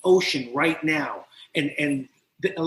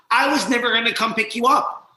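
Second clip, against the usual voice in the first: higher, louder taunt; mocking taunt; 7 semitones higher, 5 dB louder than usual. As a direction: sneer it at him. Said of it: "all of a sudden I heard".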